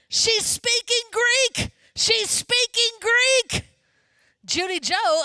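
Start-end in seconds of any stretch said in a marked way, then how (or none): 0.1-3.7 high, drawn-out excited yell. Retold or imitated. Greek minister interrupting the prayer meeting in amazement, yelling. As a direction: yell it out slowly, word by word, pitched way up. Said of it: "He started yelling".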